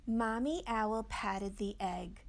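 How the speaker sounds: ordinary delivery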